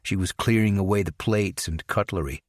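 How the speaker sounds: plain reading voice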